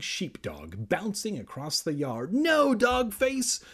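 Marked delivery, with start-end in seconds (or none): 2.3-3.6 high, slow, loud cry of no. Acted. Kermit begging the sheepdog to stop, high wail. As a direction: stretch the no out in high alarm. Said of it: "Kermit cried".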